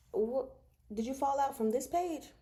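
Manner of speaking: ordinary talk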